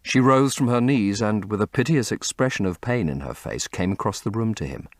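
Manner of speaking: ordinary delivery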